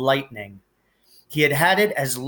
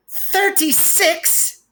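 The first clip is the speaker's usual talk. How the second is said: put-on much higher, slow spoiled-child voice; whiny Dudley; much slower than usual. A narrator's reading. Dudley counts his birthday presents, high sulky whine. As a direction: drag it out like a sulky spoiled boy.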